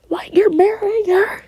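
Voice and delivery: high, startled disbelief; shocked question. Acted. Worker hearing he is marrying her, high shocked disbelief. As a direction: squeak it out in disbelief.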